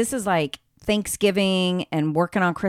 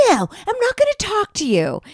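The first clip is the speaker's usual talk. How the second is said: put-on much higher, childish sulky refusal; mock petulant Cody; 13 semitones higher than usual. Friend mimicking Cody refusing to talk, pitched-up sulk. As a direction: squeak it out like a pouting kid. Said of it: "And he goes".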